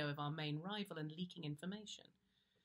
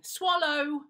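loud, high barked order; angry shout; 9 semitones higher, 14 dB louder than usual. Retold or imitated. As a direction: yell it at the spitting colleague. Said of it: "she had to keep shouting".

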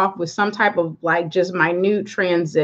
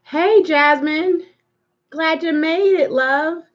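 sing-song greeting; 8 semitones higher, slower than usual. Real, not acted. Greeting a viewer on the stream.

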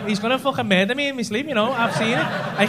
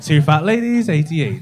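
bingo caller; 6 semitones lower, 4 dB louder than usual. Retold or imitated. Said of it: "do the bingo call and that".

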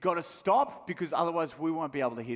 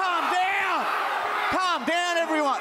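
very high strained shout; panicked mock yell; 4 dB louder than usual. Prank caller to rioting cinema crowd, high panicked yell. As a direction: yell frantically over a mob.

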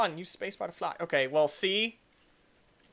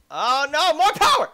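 high, loud rising cry; frantic gamer holler; urgent yell; 7 semitones higher, 9 dB louder than usual. Real, not acted. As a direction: shout for more power, climbing in pitch. Rider about to crash.

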